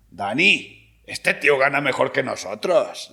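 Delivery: put-on loud, astonished Spain accent; Spanish guard. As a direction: call out to your mate as an amazed Madrid-style guard. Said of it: "Y dice".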